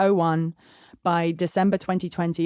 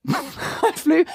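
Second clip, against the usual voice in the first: laughing; 10 semitones higher than usual. Real, not acted. Laughing at own decision.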